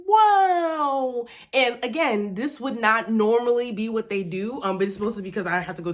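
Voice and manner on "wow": a high, loud breathy wow; amazed wow. Retold or imitated. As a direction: gasp out wow in delight. Amazed at stocked apartment.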